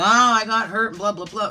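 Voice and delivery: exaggerated poor-me complaint; mock complaining. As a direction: imitate someone dwelling on their hurt. Mimics dwelling on being hurt.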